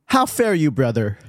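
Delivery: slow, lofty olde-English greeting; medieval speech. Acted. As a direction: play a courtly medieval gentleman. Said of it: "medieval-esque terms".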